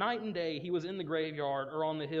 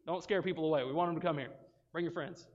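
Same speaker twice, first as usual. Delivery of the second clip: ordinary talk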